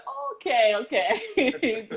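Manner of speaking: amused okay. Retold or imitated. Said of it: "I said".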